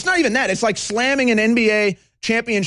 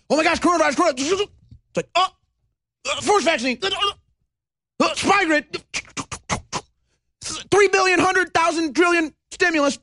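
a lightly voiced, higher-pitched caricature of panicked hype; mock panic; 8 semitones higher than usual. Host mocking fearmongers to his audience, higher mock panic. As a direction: ham up the frantic coronavirus scare talk.

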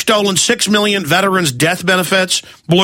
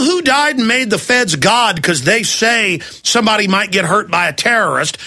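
ordinary delivery

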